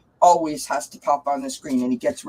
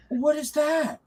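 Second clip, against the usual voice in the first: startled retelling; 10 semitones higher, slower than usual. Retold or imitated. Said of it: "I was like".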